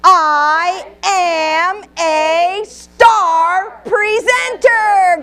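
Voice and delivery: every word stretched out, high and loud; "I am a star presenter" punched word by word, very slowly; emphasize each word. Shown as a demonstration. Trainer leading the standing crowd, each word stressed high and loud. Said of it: "we're gonna emphasize each word".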